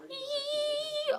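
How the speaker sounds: very high nervous squeal; excited squeal. Retold or imitated. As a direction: let out a tiny squeal of nerves. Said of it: "just kind of going".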